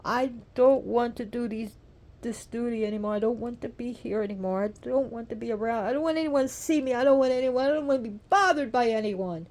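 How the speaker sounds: loud, high outburst, building in force; overwrought, insistent plea rising to a cry; acted tearful distress. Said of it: "And she's crying".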